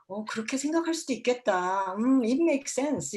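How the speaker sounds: accepting reply. Shown as a demonstration. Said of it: "이런 리액션을".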